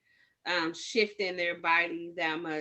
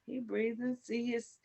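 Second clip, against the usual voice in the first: soothing; 2 semitones higher, 6 dB quieter than usual. Retold or imitated. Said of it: "I was able to be like".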